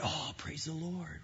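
deutlich leiser, wie ein verhaltenes Echo; leise Antwort. Retold or imitated. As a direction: das Lob leise und nur halb ernst zurückgeben.

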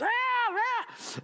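high imitated tantrum wail; high mock bawling; mock crying wail.

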